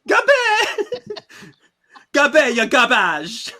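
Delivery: high, loud, drawn-out mock yell in a put-on accent; hammed-up shout. Jal insulting Gabe, hammed-up villain shout. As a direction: stretch the insult out, shouting theatrically.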